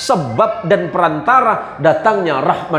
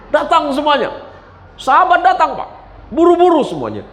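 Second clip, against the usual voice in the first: excited narration; 12 semitones higher than usual. A narrator's reading. Companions rush in.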